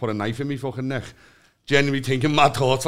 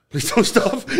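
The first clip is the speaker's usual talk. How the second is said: raised pitch, laughing as he begs; higher mock plea; 8 semitones higher, 3 dB louder, slower than usual. Pleading with his own beating heart, high and half-laughing.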